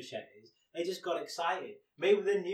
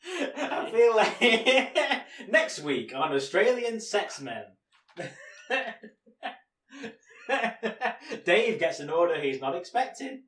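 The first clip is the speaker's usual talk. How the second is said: hammed-up trailer voice; mock TV trailer; 6 semitones higher, 6 dB louder than usual. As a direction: announce it like a cheesy show teaser.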